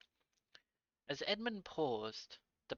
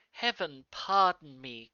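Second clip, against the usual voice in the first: loud, slow, pleading cry; a heartfelt loud plea for pardon; 2 semitones higher, 13 dB louder than usual. A narrator's reading. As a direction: fall to his knees and cry it out. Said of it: "cried Edmund".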